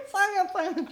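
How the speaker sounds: high laugh spilling into words. Real, not acted. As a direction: laugh while saying it. Laughing through a word.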